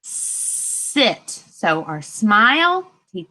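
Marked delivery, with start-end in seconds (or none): 0.0-1.3 "sit" said unvoiced with a long hissing S; whispered word. Shown as a demonstration. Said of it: "the first word on the list is".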